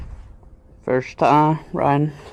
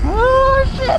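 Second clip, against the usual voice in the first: high, loud surprised yelp; startled yelp; 4 dB louder than usual. Real, not acted. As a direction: yelp as the wheel slips. Bike slips in snow.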